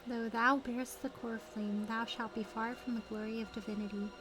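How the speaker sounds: ordinary talk, nothing marked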